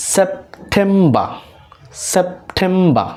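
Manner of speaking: সেপ্টেম্বর broken as "sep... TEM-ba"; silent final r. Shown as a demonstration. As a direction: say সেপ্টেম্বর slowly, pause after "sep", end on "ba". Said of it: "আর এর উচ্চারণগুলো".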